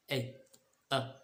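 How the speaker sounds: "ए" said as letter name, then a short clipped "अ"; name the letter "ए", then give its short sound "अ"; short vowel sound. Shown as a demonstration. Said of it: "ए का short sound है अ". ("ए" के बाद छोटा सा "अ" बोलें)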